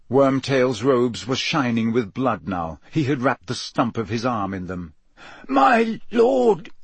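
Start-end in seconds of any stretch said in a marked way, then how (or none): 5.5-6.7 high, choked cry of a servant. A narrator's reading. Wounded servant appeals to his master, choked and high-pitched. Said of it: "he choked".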